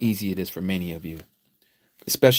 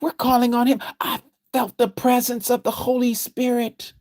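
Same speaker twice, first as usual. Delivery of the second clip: much higher, mocking believer voice; 13 semitones higher than usual. Preacher mocking Christians who say they felt the Spirit, much higher. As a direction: parody a believer insisting they can't be tricked. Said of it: "because you're saying".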